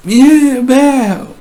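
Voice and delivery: high, loud imitated bellow; mock yell. Imitating a father bellowing at his child. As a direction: let out a sudden shout. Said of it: "dá um berro nessa criança".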